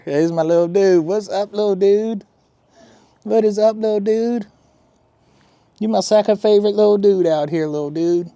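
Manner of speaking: high, loud pet-greeting voice; baby talk to pet. Acted. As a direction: coo up at the cat like baby talk. Fusses over the cat.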